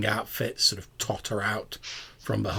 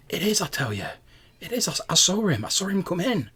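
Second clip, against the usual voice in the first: put-on higher-pitched, insistent young woman; young woman's voice; 9 semitones higher than usual. Game master voices a young woman from behind crates, insistent and high. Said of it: "a young woman's voice saying".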